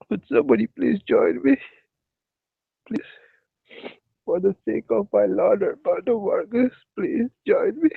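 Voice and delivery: high, whiny mock begging; high mock whine, don't leave me; whiny pleading. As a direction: whimper like an abandoned child.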